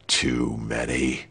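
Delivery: low, slow, quiet query; dangerously soft and low disbelief; menacing governor. A narrator's reading.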